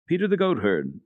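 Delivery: plain reading voice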